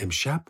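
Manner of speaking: «امشب؟» rising sharply at the end; questioning echo. A narrator's reading. Echoes the word as question.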